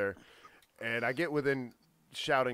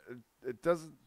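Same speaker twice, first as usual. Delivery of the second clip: ordinary talk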